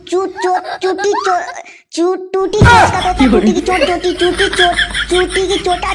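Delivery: giggling through a botched tongue twister; laughing. Acted. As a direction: crack up while tripping over the words. Stumbling tongue twister, cracking up.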